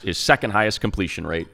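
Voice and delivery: usual speaking voice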